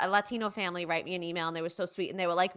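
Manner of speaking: ordinary talk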